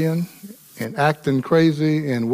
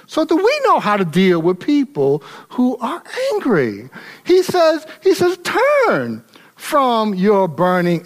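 preaching at full force; 7 semitones higher than usual. Acted. Pastor urging his church on how to answer anger, full force. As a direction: lift the congregation with rising fire.

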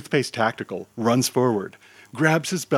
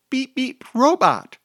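put-on very high toddler squeal, building louder; excited toddler; 13 semitones higher, 6 dB louder than usual. A narrator's reading. Toddler explains to the hero, high and excited. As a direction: squeak it out like a thrilled small child. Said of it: "the toddler says excitedly".

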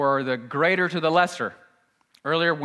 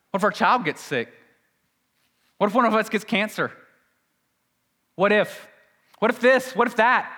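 high, louder, panicky run of what-ifs, building worry; anxious fearful voice; 6 semitones higher, 4 dB louder, faster than usual. Retold or imitated. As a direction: play the frightened crowd's spiralling questions.

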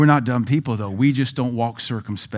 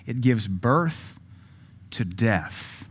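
slow "birth", softer falling "death"; emphatic repeat; 4 dB quieter, much slower than usual. Shown as a demonstration. Preacher dwelling on the image for the congregation, slow and heavy. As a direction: hold birth, then drop onto death. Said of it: "what a mental picture".

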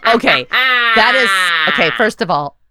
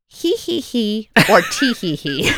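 put-on child's giggle demo; 4 semitones higher, slower than usual. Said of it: "Mom, what's more realistic?".

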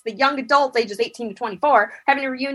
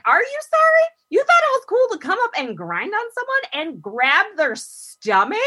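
mock outrage; 9 semitones higher than usual. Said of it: "I'm like".

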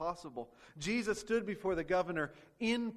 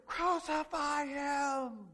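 mob shouting; 8 semitones higher, much slower than usual. Acted. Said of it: "they shouted all the louder".

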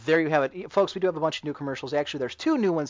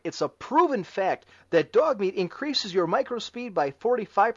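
chipper 1950s ad; 3 semitones higher than usual. Acted.

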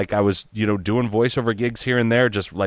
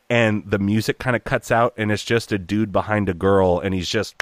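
ordinary talk

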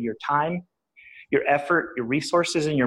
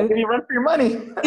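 higher-pitched, laughing retort; 11 semitones higher, 3 dB louder, faster than usual. Real, not acted. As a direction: say it through a laugh. Amused boast answering her chattiness.

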